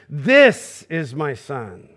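low, slow and solemn; solemn divine pronouncement; voice of God. Acted. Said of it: "God's voice had said".

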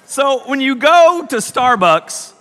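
ordinary talk, nothing marked

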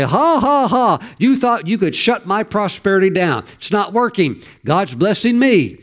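imitated high ha-ha-ha into a defiant taunt; mocking laughter. Businessman taunts the devil at night, high imitated mocking laugh. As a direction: start on a high mock laugh, then declare it boldly. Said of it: "laugh and laugh and laugh at the devil".

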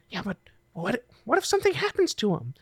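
soft, high-pitched worried voice; nervous kid. Acted. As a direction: voice a kid's anxious question, hushed and high. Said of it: "look at each other nervously".

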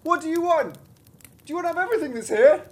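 very high squeaky little voice; crying out. Acted. Thumbling carries on to the thieves, crying out squeakily. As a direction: squeak it out like someone tiny. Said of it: "Thumbling carried on".

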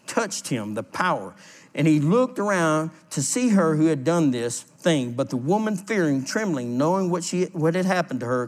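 ordinary talk

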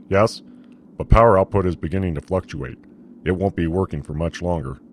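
a strained officer, anxious; commander voice. A narrator's reading. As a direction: warn the captain it's failing. Commander character voice.

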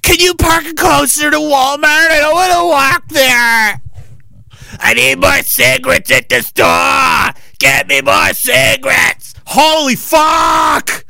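hammed-up greedy whine; mock whiny glutton. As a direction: play a demanding lazy slob shouting orders. Impersonating obese person.

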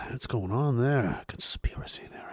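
hushed, secretive curiosity; intrigued hush. Retold or imitated. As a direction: whisper it like a fascinated kid. Acting out childhood curiosity.

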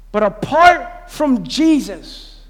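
"apart from Jesus" stretched very slow and heavy; slow weighty warning. Acted. Pastor warning the church, drawn out slow. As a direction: linger on every word of "apart from Jesus".

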